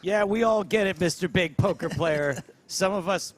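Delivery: loud, high, pompous put-on voice; mock a boastful dad loudly; mocking father-in-law. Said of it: "A show of dominance".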